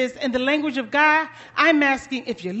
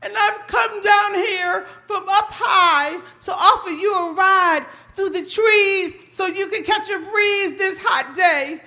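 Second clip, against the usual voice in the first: higher, louder wounded indignation; offended buzzard; 5 semitones higher, 3 dB louder than usual. Acted. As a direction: scold like an offended friend. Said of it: "Buzzard said".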